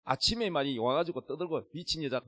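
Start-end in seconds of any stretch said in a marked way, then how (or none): none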